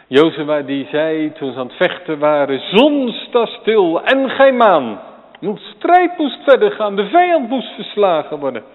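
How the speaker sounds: loud, high, rousing pulpit cry; fiery sermon climax; preaching at full force. Acted. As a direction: thunder it out to the congregation.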